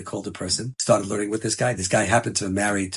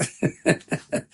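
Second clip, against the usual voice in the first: disbelieving laugh. Retold or imitated.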